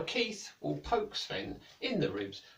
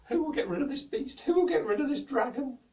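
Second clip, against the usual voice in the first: high, loud, wailing king's voice; king crying out in a nightmare; 11 semitones higher, 5 dB louder than usual. Acted. King in his sleep, distressed and high-pitched. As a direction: moan it out like a king in a bad dream. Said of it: "He was saying".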